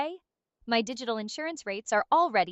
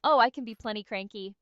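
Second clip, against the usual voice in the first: normal talking voice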